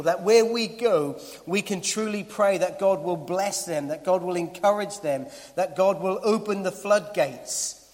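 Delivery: impassioned preacher climbing in force; preaching at full force. Acted. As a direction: drive the list home from the pulpit.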